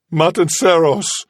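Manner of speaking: high, rising fearful answer; frightened blurt. Acted. Seaman confessing to Kazala, frightened. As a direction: blurt it out in fear.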